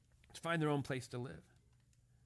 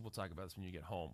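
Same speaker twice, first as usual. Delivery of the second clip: low, soft and fast dad warning; ominous quiet warning; 11 semitones lower, 10 dB quieter, faster than usual. Retold or imitated. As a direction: keep it quiet and loaded with threat.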